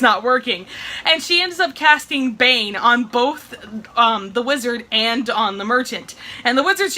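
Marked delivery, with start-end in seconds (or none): none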